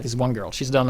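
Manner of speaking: ordinary delivery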